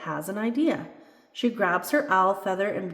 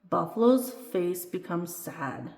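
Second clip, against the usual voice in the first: usual speaking voice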